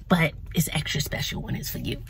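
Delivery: whispered sweet reply; imitating a soft-spoken lady's whisper. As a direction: breathe it softly like a tender secret.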